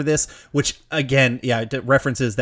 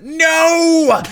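high, loud mock no; mock angry shout; 5 dB louder than usual. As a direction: bark a mock furious no. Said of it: "he gets way too angry".

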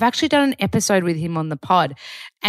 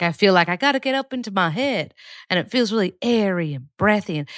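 normal talking voice

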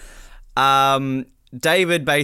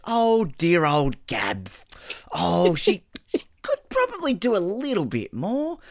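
high, slow and sugary, a laugh breaking in; sweet mock Nicole; 5 semitones higher, slower than usual. Imitating a contestant sweetly delivering sass. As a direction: coo it like the nicest person alive. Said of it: "She's like".

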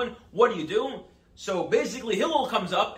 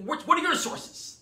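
indignant challenge; 8 semitones higher, slower than usual. Retold or imitated. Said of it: "And the guy, like".

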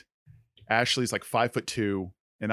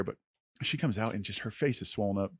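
normal talking voice

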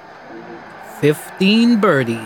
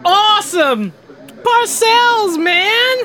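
excited cheer; much slower than usual. Acted. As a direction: cheer the play like a fan. Cheering at the football play.

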